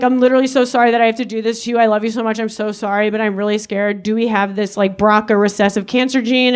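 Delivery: low, hurried mock plea; retold anxious apology. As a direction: rush out a subdued apology to mom. Retold scared apology to mother.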